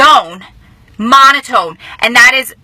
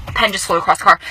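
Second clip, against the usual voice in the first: ordinary delivery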